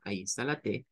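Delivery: ordinary talk, nothing marked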